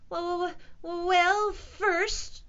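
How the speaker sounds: high, halting repeated wells; stammering. A narrator's reading. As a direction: stammer nervously. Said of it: "stammered Keith".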